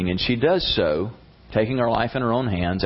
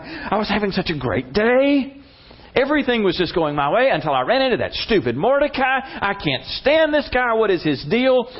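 high-pitched exasperated rant; seething; 11 semitones higher than usual. Retold or imitated. Haman ranting at home about Mordecai, seething. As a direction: fume in a worked-up, high voice. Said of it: "he's seething".

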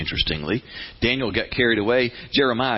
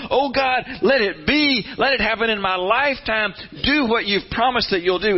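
much higher, fervent plea; crying out to God; 9 semitones higher than usual. Acted. Daniel begging God to act now, fervent pleading cry. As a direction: lift the voice in urgent pleading. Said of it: "he starts crying out to God".

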